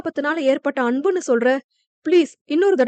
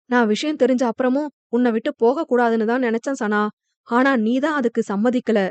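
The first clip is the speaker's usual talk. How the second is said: usual speaking voice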